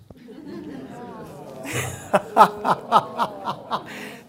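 long laugh breaking into loud high bursts; helpless, high-pitched laughing fit; real laughter. Real, not acted. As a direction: crack up at your own corny joke. Laughing at own corny pun.

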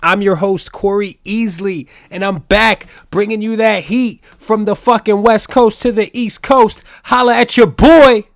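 loud, high hype-man announcer; hyped podcast intro. Real, not acted. Host welcoming listeners back to the podcast, hyped announcer. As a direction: open the show like a party hype man, building to a shouted sign-off.

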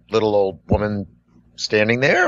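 ordinary delivery

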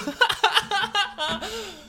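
real laugh in a high squeal. Real, not acted. After the rap. (hohes quietschendes Lachen)

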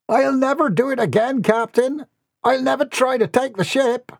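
high-pitched begging pirate voice; pleading pirate. Acted. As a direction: beg the captain for forgiveness, high.

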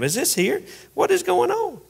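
startled, pitch jumping up high on the question; a sudden high squeak of confusion; baffled congregant. Pretend. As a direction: blurt it out in disbelief. Congregant baffled by guest rabbi.

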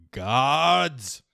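commanding holler; calling out. Acted. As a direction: summon the guards with a shout. Villain calls out for his guards.

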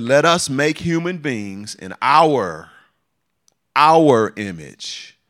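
slow, loud, drawn-out proclamation; slow booming pulpit voice; preaching at full force. Real, not acted. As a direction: proclaim it to the congregation. Preacher quoting God with force.

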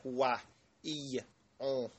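"wa", "i", "on" as isolated nasal demo sounds; nasal French sounds. Shown as a demonstration. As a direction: sound out "wa", "i", "on" through the nose, pausing between. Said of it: "a lot more of a nasal sound".

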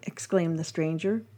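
ordinary talk

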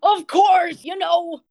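jovial barkeep. Acted. Barkeep answers goliath in character.

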